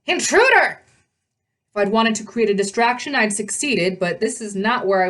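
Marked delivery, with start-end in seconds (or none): 0.0-0.8 high, loud shout of alarm; alarmed call. A narrator's reading. A sentry raises the alarm from the balcony, shouting high. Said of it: "a voice said from the balcony above me".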